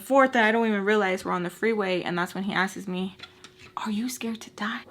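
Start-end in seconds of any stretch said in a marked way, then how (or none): 3.8-4.8 whispered imitation of a threat; hushed menacing question. Ex's threat acted out.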